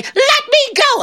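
very high, drawn-out wail; panicked plea. Acted. Trapped devil begs John to free him, panicked. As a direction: beg for release in a high cry.

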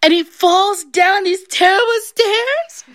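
high wail, climbing to the last word; drawn-out, hammed-up distress; building to crying. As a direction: work yourself up into a mock wail. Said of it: "She starts crying".

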